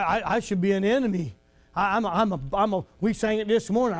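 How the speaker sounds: ordinary talk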